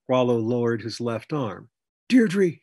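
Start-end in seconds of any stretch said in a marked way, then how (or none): none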